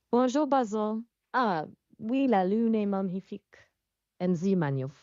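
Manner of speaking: second go at a French accent. Shown as a demonstration. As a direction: attempt a French accent, a bit uncertainly. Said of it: "can you try French again?".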